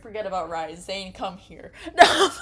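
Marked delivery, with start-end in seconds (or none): 2.0-2.3 high, loud laughing refusal. Real, not acted. Gamer laughing loudly at the romance option, high.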